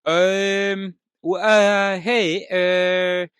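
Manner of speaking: loud, high, hammed-up French accent. Dad asking his wife to be on time, loud French accent. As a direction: call out as a halting Frenchman, French accent laid on thick. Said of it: "I can only do the, uh, French".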